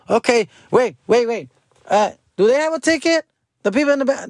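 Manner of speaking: high, sing-song mock attendant; attendant catching on. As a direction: play the fussy gatekeeper spotting the kids. Attendant voiced, catching the kids.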